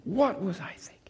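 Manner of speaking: breathy whisper of regret. Acted. Jonah in the fish, regretting his choice, whispered. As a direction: sigh it out under your breath.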